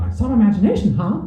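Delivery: high, squeaky cartoon mouse; high-pitched cartoon finale line; Mickey Mouse. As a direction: jump up into the character's high voice. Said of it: "using my voice for the finale".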